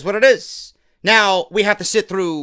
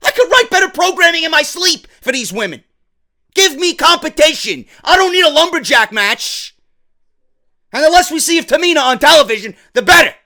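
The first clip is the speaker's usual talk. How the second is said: exasperated rant; 5 semitones higher, 3 dB louder than usual. Real, not acted. Ranting about women's booking.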